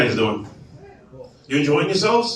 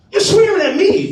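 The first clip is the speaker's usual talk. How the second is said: high-pitched aggrieved protest; comic wounded indignation; indignant retort; 14 semitones higher than usual. Retold or imitated. Comedian protests to wife.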